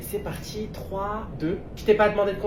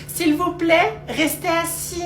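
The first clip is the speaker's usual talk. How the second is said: high, loud order to passengers; exasperated crew member calling out; 8 semitones higher, 5 dB louder, much slower than usual. Acted. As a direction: raise your voice over a cabin. Cabin crew calls to standing passengers.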